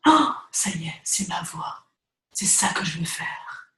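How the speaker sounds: hushed whisper of sudden insight; whispered inner click of finding her voice; whispered revelation. Retold or imitated. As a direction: breathe it like a private epiphany. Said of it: "t'auras un déclic dans ta tête".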